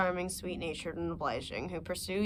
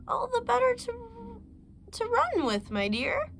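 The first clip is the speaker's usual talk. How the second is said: much higher, sugary fake granny voice; wolf faking grandma. Wolf in bed answers the girl in a sweet fake granny voice. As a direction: hide the wolf behind a sweet old lady.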